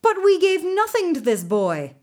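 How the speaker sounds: loud, high protest; arguing. A narrator's reading. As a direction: argue back defensively. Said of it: "they argued".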